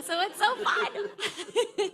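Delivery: laughing with delight; bubbling laugh at the coincidence. Real, not acted. Speaker delighted to find her old coworker here, laughing.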